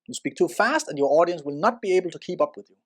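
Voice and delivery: rushed, hurried delivery; fast. Shown as a demonstration. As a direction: rattle it off too fast. Demonstrates speaking too fast.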